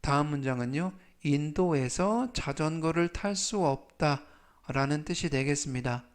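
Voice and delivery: normal talking voice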